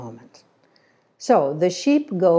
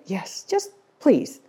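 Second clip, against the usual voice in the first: slow, drawn-out plea; lightly acted begging for a favour; pleading; 3 semitones higher, slower than usual. Researcher begging the shepherd for a favour, pleading.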